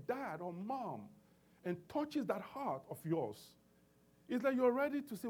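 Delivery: ordinary talk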